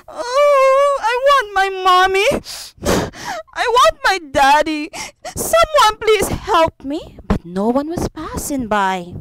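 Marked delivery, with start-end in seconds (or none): 0.1-7.2 put-on high, wailing child begging for help; hammed-up sobbing plea; crying little boy. Lost boy crying for his parents by the roadside, sobbing. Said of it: "he started to cry".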